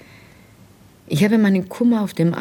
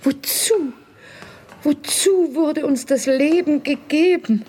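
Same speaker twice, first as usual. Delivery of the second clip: despairing cry; 9 semitones higher than usual. Acted. Despairing question before arrest.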